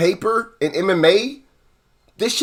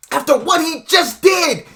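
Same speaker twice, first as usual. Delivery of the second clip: shouted much higher, outraged; angry shout; 8 semitones higher than usual. Real, not acted. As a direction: yell the question in disbelief. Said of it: "I'm really mad".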